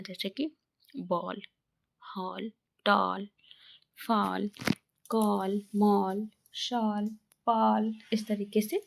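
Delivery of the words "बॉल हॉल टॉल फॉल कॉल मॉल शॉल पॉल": बॉल, हॉल, टॉल with a long rounded 'aw'; one word at a time, rounded 'aw' in कॉल, मॉल, शॉल; 'aw' vowel demo. Shown as a demonstration. Teacher showing A said as औ, drawn-out 'aw' in बॉल. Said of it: "ए का उच्चारण औ की मात्रा होता है".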